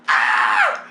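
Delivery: hammed-up high scream of panic; loud mock-terrified shriek; freaking-out scream. Hanging on to the gutter, freaking out, panicked scream. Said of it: "I'm freaking out like".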